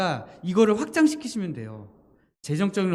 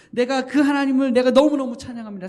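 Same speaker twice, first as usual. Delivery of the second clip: louder, higher burst of praise; fervent praise; 9 semitones higher, 7 dB louder than usual. Shown as a demonstration. Preacher acting out a praying believer, jubilant. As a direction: lift it up in full-throated worship. Voicing an exuberant praise prayer.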